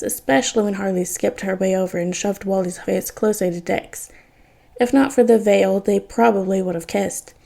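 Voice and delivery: usual speaking voice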